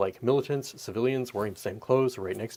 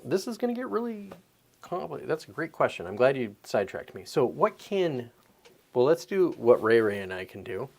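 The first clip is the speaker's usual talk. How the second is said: ordinary talk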